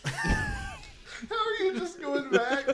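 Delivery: high, slow, laughing in disbelief. Real, not acted. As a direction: cry out through laughter. Laughing incredulous.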